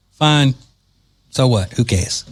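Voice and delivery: ordinary talk, nothing marked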